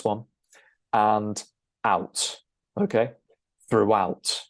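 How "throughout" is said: "Throughout" as "throo-WOUT", stress on "out"; intrusive W. Shown as a demonstration. Teacher showing the linking sound, "Throughout" with a W before "out". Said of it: "an intrusive W sound between".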